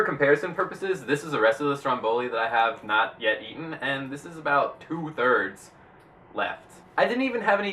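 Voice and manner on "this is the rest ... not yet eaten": usual speaking voice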